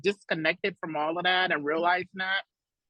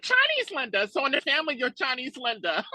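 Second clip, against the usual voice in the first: high, matter-of-fact aunt; high voiced aunt naming Chinese Linda; aunt explaining; 6 semitones higher than usual. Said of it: "She's like".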